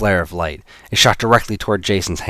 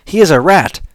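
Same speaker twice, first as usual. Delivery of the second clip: raised, urgent explaining voice; urgent young woman; 10 semitones higher, 8 dB louder than usual. Acted. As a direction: press the point urgently. Eve urgently explains to Alex.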